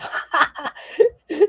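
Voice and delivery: high cackling burst; helpless high giggle; imp laughter. Acted. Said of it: "Starts laughing".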